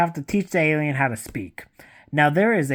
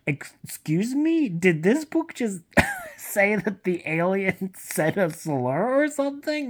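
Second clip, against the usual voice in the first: much higher, laughing disbelief; incredulous; 8 semitones higher than usual. Retold or imitated. Reader reacting to the alien's slur, high incredulous laugh. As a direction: squeak it out in shocked disbelief. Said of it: "And I'm like".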